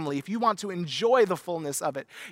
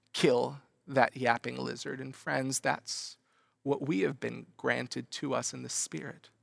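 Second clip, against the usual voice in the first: ordinary delivery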